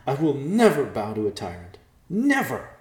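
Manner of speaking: building to a high, loud cry; defiant shout. Acted. Yolana defies the messenger.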